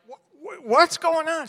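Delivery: high, sputtering what's going on; a high, stunned mock stammer; startled disbelief. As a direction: caught off guard, stammering. Startled as wife jogs.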